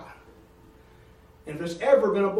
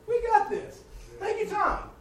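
put-on much higher, sassy little baby talking back; mock baby voice; 12 semitones higher, slower than usual. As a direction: play the baby, cheeky and amused. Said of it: "You think he's gonna say".

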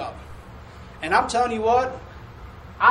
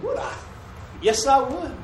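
eager reply; 5 semitones higher than usual. Retold or imitated. Eager reply to job offer.

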